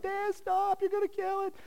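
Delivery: put-on high pleading child voice; imitating crying kids. Kids begging dad to spare the dog, high child voice. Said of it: "my kids are crying. They're like".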